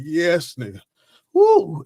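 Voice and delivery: high, loud triumphant whoop; relieved cheer. Retold or imitated. Boy scratching under his cast with a hanger, triumphant whoop. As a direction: cheer like finally scratching the itch. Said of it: "And I said".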